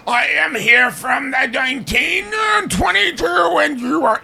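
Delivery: high, hammed-up shouting spirit voice; funny psychic voice. Mocking TV psychics, a high shouted funny voice. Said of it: "You talk in a funny voice like".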